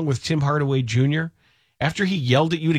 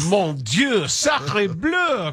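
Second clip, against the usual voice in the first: hammed-up French exclamations; French accent; 5 semitones higher, much slower than usual. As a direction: play an outraged Frenchman.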